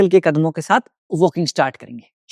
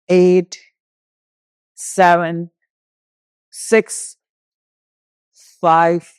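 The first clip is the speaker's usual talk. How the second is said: single counts, raised pitch, long gaps; exercise countdown; 4 semitones higher, much slower than usual. Real, not acted. Yoga teacher counting down jogging for the class, instructor's countdown. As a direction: call each number out on the beat.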